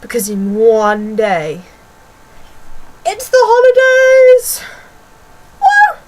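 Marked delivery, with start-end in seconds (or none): 3.0-6.0 high, loud, drawn-out celebratory whoop; excited cheer. Pretend. Sharing excitement with viewers that holidays are near, excited cheer. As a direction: shout it out like school just broke up.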